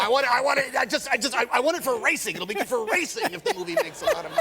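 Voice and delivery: high, stammering eagerness; zealous pleading. Acted. Imitating a racing fan wanting it for the sport, zealous. Acting out Newman's racing zeal.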